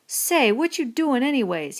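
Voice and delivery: crying out. A narrator's reading. Lenny protesting to Crooks, alarmed cry. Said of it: "he cried".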